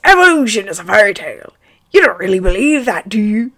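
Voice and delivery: wise Hagrid. Acted. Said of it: "Hagrid laughed wisely".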